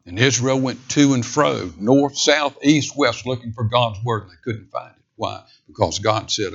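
ordinary delivery

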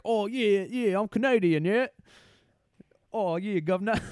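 high, loud mock Cockney; bad Cockney Canadian. Actor showing off his Canadian accent, comically Cockney. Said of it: "it was, like, a Cockney British person".

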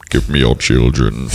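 slow, very low monster voice; Krampus. Acted. Host teased about looking like a monster, slow low creature voice. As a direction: demand it as a low, slow ogre. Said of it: "You look like Krampus".

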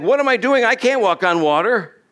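lightly hammed-up high panic; Peter panicking. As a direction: act out sudden alarmed doubt. Acting Peter panicking at the waves.

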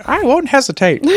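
high, squeaky puppet voice; Elmo voice. Acted. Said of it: "it sounded almost very Elmo".

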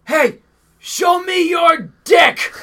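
high, loud drawn-out holler; retold yell. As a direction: imitate a buddy yelling across a parking lot. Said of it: "Just yelling".

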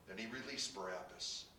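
usual speaking voice

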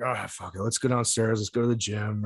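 just dragged out of bed; sleepy mumble, barely awake; groggy. Retold or imitated. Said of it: "I stumble out of my bedroom".

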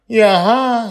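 put-on high, loud child asking back; a small boy confused by the question; puzzled little boy. Charlie child voice.

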